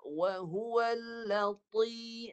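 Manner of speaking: Wa huwal drawn out very slowly in Quranic recitation. Shown as a demonstration. Teacher models Quran recitation for the student. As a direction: chant the verse slowly, heavy on the letters. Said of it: "pangkal lidah, tengah lidah naik".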